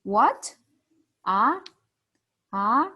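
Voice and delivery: "what" and "Ah" rising sharply; second tone, questioning. Shown as a demonstration. Teacher demonstrates second tone as a surprised, rising question. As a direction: lift "Ah" upward like a surprised question. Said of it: "surprise sound or question sound".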